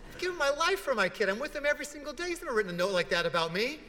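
high, loud, rapid indignant rant; indignant outburst. Retold or imitated. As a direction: rattle it off as an outraged, hurt father. Indignant father retold, acted rant.

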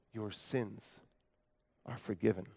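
soft, low, nearly breathed words; hushed healer. Acted. The healer speaks down to the man on the mat, hushed and soft. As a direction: say it softly as the healer.

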